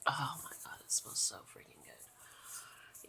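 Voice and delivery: hushed, slow whisper of delight. Real, not acted. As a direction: sigh it out, savoring. Savoring lavender scent.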